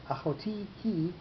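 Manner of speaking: "achoti, he" with TI and HE both stressed, back to back; two adjacent accents. Shown as a demonstration. Teacher showing the awkward clash, "achoti, he" with adjacent stresses. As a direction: softly stress the end of "achoti", then "he" straight after. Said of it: "two accented syllables coming right after each other".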